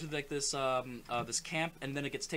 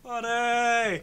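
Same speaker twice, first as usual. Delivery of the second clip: loud, held call back; mock reply; 7 semitones higher, 8 dB louder than usual. Playing kids answering the counselor, mock shout.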